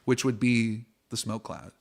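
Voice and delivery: hushed, unhurried scene setting; game master narration. Acted. GM to players, setting the death scene, hushed. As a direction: draw the players into the dark.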